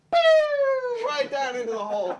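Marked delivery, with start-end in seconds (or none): 0.1-1.1 very high, loud "pew"; falling whistle effect. Acted. Silly creature going down into the hole, high imitated whoosh. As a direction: imitate a comic plummet, piercingly high. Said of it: "as this silly creature, go".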